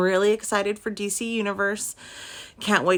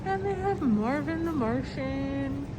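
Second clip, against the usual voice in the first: muffled voice; 7 dB quieter than usual. Real, not acted. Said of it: "sorry I sound muffled. Mask's inside".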